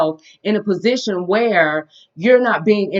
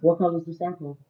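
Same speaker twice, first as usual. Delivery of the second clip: put-on reading to a toddler; 5 semitones lower, 8 dB quieter, slower than usual. Teaching toddler shapes.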